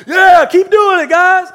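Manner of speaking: high, loud, slow call across rooms; cheering from another room. Retold or imitated. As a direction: stretch it out to reach the next room. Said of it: "literally cheering from another room".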